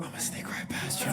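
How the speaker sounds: soft whisper imitating an older lady; hushed, voiceless murmur in passing. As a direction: whisper it onto someone's neck. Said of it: "she whispers on the back of my neck".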